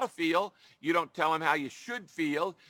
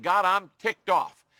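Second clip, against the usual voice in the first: ticked-off complaint; 3 semitones higher, 7 dB louder, slower than usual. Retold or imitated. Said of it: "He's saying".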